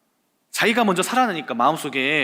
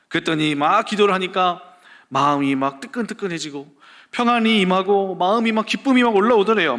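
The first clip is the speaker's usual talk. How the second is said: ordinary talk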